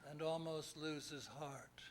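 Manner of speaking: measured ballad reading with pauses between lines; poem recitation. Acted. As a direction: recite an old-fashioned ballad, line by line.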